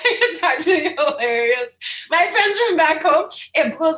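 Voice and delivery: high, loud, amused laughing speech; can't keep the laugh out of it. Real, not acted. Telling interviewer friends can't believe her PhD, laughing.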